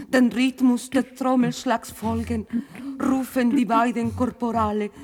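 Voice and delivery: normal talking voice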